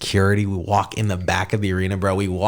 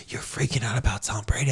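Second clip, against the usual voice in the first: hushed whisper to yourself; a secret starstruck whisper; whispered aside; 8 dB quieter than usual. Retold or imitated. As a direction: whisper it so nobody hears. Retelling inner reaction to Dana.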